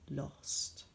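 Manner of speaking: breathy whispered word after a pause; dramatic whisper. A narrator's reading. Pete whispering to the narrator for effect. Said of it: "He paused for dramatic effect".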